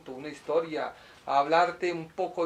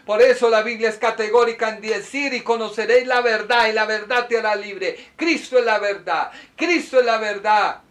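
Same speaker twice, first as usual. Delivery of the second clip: high, loud pulpit proclamation; thundering, impassioned declaration of faith; fiery preaching; 5 semitones higher, 10 dB louder than usual. Real, not acted. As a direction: proclaim it at full force. Preaches at full force.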